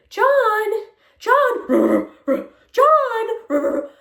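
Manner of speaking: high, loud, laughing calls of John; mock owner calling dog. Mocking an owner calling a dog that ignores her. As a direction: call the dog like a helpless owner. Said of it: "it's like".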